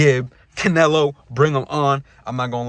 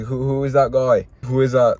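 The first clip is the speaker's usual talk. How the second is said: a bad, hammed-up Irish accent on who is that guy; badly faked Irish brogue, a little louder; terrible McGregor accent; 3 dB louder than usual. Mocking a newcomer in a bad Irish accent. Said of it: "That was a terrible McGregor accent".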